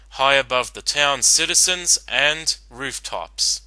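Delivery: usual speaking voice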